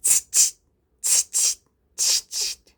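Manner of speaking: voiceless "tst" monkey chatter. A narrator's reading. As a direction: mimic monkeys with sharp little tongue-and-teeth noises. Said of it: "the monkeys only shook their fingers back at him and said".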